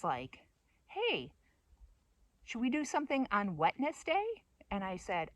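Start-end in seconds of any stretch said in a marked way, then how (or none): 0.9-4.2 high, eager suggestion; upbeat, pitched-up invitation; perky friend. Retold or imitated. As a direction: lightly imitate a friend pitching plans. Friend's proposal, acted out.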